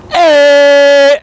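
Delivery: loud, high, held "eh"; uninhibited teaching yell; demonstration yell. Shown as a demonstration. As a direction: yell it out long and unashamed. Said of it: "So I'll go".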